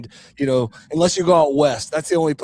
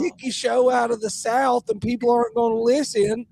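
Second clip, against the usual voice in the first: higher-pitched Southern scolding; hammed-up high Southern twang; mock twang; 8 semitones higher than usual. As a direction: scold in a put-on country twang.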